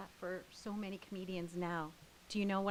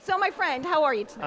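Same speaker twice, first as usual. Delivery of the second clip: loud host greeting; 8 semitones higher, 12 dB louder than usual. Real, not acted. Host greets loudly over crowd.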